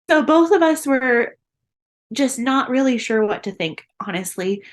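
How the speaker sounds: usual speaking voice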